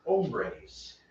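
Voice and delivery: put-on Spanish word with a thick gringo accent.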